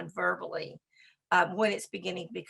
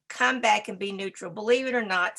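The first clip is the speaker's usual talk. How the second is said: usual speaking voice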